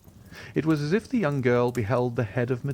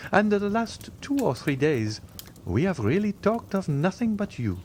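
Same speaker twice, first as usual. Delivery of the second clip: society lady; 10 semitones higher than usual. Acted. Madame Danglars voiced by narrator.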